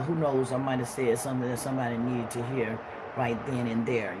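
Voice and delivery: ordinary talk, nothing marked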